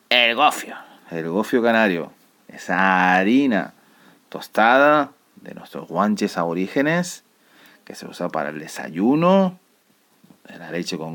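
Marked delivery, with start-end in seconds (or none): none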